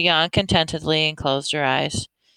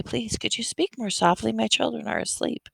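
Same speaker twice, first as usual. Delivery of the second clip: higher, soft pleading mother; 7 semitones higher, 3 dB quieter than usual. A narrator's reading. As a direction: ask gently, voice raised high. Mama pleading for sleeping children.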